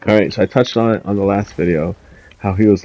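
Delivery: ordinary talk, nothing marked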